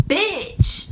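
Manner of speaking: sudden high indignant "Bitch"; indignant outburst. Retold or imitated. Sitting in her hit car, a high indignant burst. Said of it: "I'm just thinking, like".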